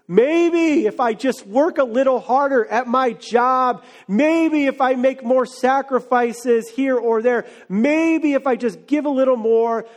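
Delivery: high, insistent pleading; desperate bargaining. Acted. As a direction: pile up each maybe like a frantic bargain. Acts out striving, bargaining prayer.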